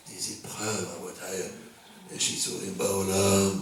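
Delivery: slow, low, drawn-out mock piety; mock solemn droning. Teacher mocking pious talk of trials, slow solemn drone. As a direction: intone it slowly and low, sending it up.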